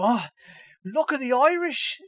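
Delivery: high, mock-Irish exclamation; fake Irish accent. Host mocking exaggerated voiceover accents, fake Irish accent. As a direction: ham up an unconvincing Irish accent. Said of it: "this Irish guy that's clearly not Irish".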